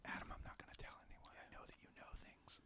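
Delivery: hushed secret whisper. Retold or imitated. Voicing Max whispering to robot.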